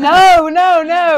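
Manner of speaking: laughing, flustered denial; loud denial. Real, not acted. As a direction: shoot the idea down fast. Denying friend's tease.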